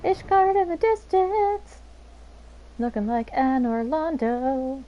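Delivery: high, slow theatrical awe; mock grandiose. Real, not acted. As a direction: drawl it out like a trailer. Mock dramatic slow reading.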